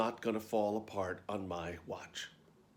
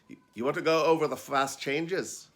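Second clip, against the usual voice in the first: put-on louder, higher amateur Scottish accent; terrible Scottish accent; 4 semitones higher, 6 dB louder than usual. Scottish dresser offering costume practice, bad Scottish accent. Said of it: "I do a terrible Scottish accent".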